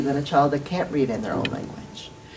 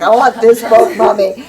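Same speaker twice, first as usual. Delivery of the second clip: put-on higher, louder pleading little-kid voice, laughing; louder child's plea breaking into a laugh; little boy; 4 semitones higher, 7 dB louder than usual. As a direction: play the kid begging for his favourite book. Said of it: "he's like".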